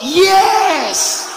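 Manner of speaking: high, loud slow exclamation; to the laughing crowd, loud and high; loud punchline. Acted. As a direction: belt the punchline out. Punchline, preacher shouts yes.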